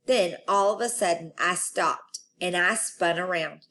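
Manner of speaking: plain reading voice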